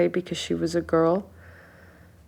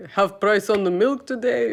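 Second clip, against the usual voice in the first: a hint of a charming dad at the checkout; playful mock compliment, lightly done; dad flirting; 4 semitones higher than usual. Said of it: "And you'd be like".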